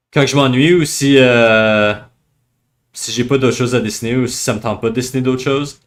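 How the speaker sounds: casual Canadian French, as spoken off the cuff; Canadian French accent. Real, not acted. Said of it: "je parle français, euh, canadien, donc l'accent est un peu étrange".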